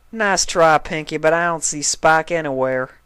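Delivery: lightly voiced country farm pony; a hint of a drawling farm mare, curious; Applejack voice. A narrator's reading. Applejack checking on her cellar, lightly voiced country mare.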